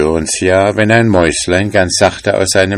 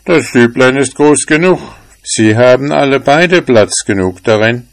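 lightly voiced big house dog, a bit higher and louder; a hint of a gruff old dog; house dog Kalif; 6 semitones higher, 5 dB louder than usual. A narrator's reading. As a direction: play the big dog, sure of himself.

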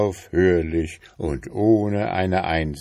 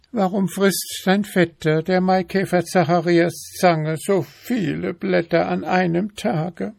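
high-pitched, fussy wife voice, louder; nagging wife beetle; 13 semitones higher, 4 dB louder than usual. A narrator's reading. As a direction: pester him in a high, insistent voice. Wife questions husband.

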